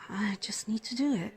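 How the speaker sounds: soft whisper to herself; whispered resolve. Acted. Nurse deciding to stay with the patient, whispered. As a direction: breathe it out as a private decision.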